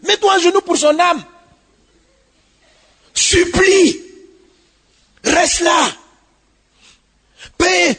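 fiery sermon voice; preaching at full force. Acted. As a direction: hammer it home to the congregation.

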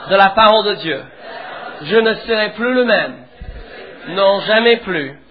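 loud, measured declaration for the church to repeat; fervent preacher leading a call-and-response; preaching declaration. Acted. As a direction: proclaim each phrase for the congregation to echo. Congregation repeats declaration after preacher.

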